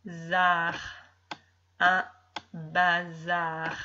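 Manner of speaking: put-on slow, careful reading of syllables to a child. Shown as a demonstration. Teacher reading syllables aloud to a young pupil, slow and clear. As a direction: read out each syllable clearly, one at a time.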